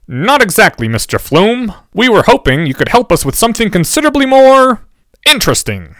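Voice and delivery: a hint of a polite, scheming teenager; lightly voiced Fred. A narrator's reading.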